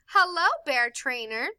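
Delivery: plain reading voice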